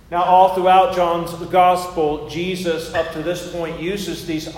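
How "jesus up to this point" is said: ordinary delivery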